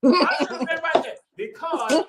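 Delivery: laughing. Real, not acted. Laughing about being left out.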